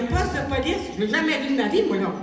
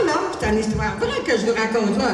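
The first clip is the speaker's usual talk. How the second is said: ordinary talk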